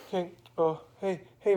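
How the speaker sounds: halting hello after a mild sniffle; sniffly little girl. Acted. Susie Q greets Mister Magoo, sniffly and hesitant. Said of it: "sniffled a bit and said".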